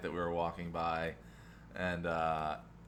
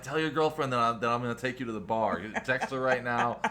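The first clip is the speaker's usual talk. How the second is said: raised, louder imitation of a cheeky flirt; teasing boast; 5 semitones higher, 6 dB louder than usual.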